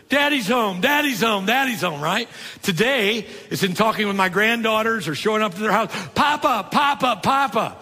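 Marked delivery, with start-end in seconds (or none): 0.1-2.0 put-on higher, excited kids calling Daddy's home. Kids run up to their dad when he gets home, excited and higher. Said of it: "what was their word". 6.1-7.8 much higher, delighted little granddaughters calling Papa. Retold or imitated. Granddaughters greet their grandpa at the door, delighted and high. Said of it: "That's how they address me".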